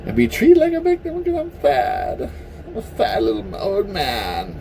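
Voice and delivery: mocking pitiful little old man; mock victim. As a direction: sneer at them as a whining victim. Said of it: "think it's okay to be a victim".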